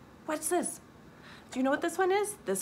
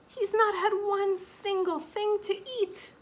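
pitying; 10 semitones higher than usual. A narrator's reading. Mama pities her sleeping baby.

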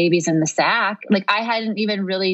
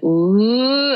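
rising labor moan; 5 semitones higher, 3 dB louder than usual. Retold or imitated. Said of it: "this low sound starts getting higher and higher".

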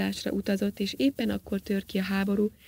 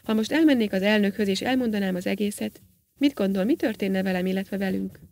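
usual speaking voice

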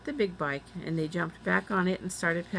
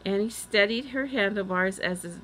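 ordinary delivery, nothing marked

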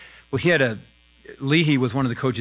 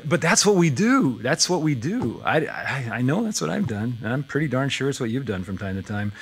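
ordinary delivery

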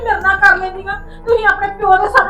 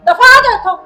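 loud, higher furious order; angry shout; 5 semitones higher, 5 dB louder than usual. Acted. Mother throws daughter-in-law out.

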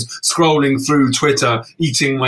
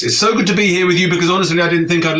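quick and bright; 4 semitones higher, much faster than usual. Acted. Refrain, quick upbeat take.

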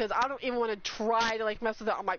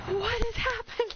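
very high, bewildered cry; high-pitched disbelief; shocked squeal; 9 semitones higher than usual. Real, not acted. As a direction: squeal it, completely overwhelmed. Overwhelmed by gifted subs.

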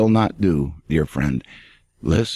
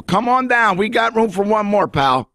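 much higher, cheery sarcastic invitation; jovial retort; 12 semitones higher than usual. Acted. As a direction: welcome them in with a wink. Preacher retorts to excuse-makers.

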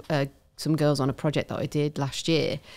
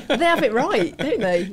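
laughing; 7 semitones higher, 3 dB louder than usual. Real, not acted. Still laughing after the accent.

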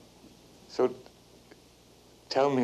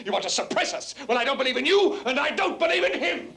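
higher and higher, building to near shout; furious tirade; 10 semitones higher than usual. Acted. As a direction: rant at them both, climbing in pitch. Son's escalating tirade at mother.

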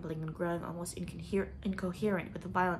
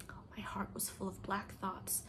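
whispered despair; dark whisper; 8 dB quieter than usual. Acted. Leslie telling Anne her despair, whispered.

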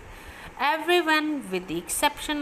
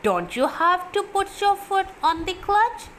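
put-on curious young boy; 10 semitones higher than usual. A narrator's reading. Boy's question from the car.

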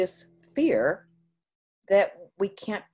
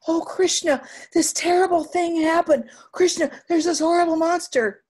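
high, loud, frantic alarm; panicked report; 10 semitones higher, 7 dB louder than usual. Acted. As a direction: blurt it out to your brother in panic. Terrified brother recounts the monster.

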